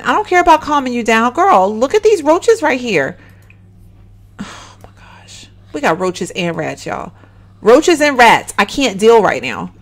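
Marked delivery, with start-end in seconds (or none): 4.4-5.5 slow, breath-only whisper. Real, not acted. Player dismayed by roaches and rats, whispering. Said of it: "Yes, I'm whispering".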